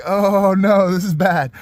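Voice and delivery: hammed-up "oh no" dread; retold alarm. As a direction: exclaim it like things just went wrong. Retells his alarm on bridge.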